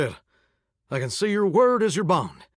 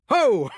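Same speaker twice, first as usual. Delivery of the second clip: hearty laugh; 8 semitones higher than usual. Acted. Shower laughing at the visitor's joke, a hearty burst.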